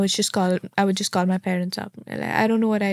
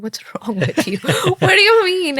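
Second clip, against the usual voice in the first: laughing and pitched high, louder; shocked half-laugh at the driver; new arrival startled by the cab driver; laughing disbelief; 9 semitones higher, 5 dB louder than usual. Retold or imitated. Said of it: "And I'm like".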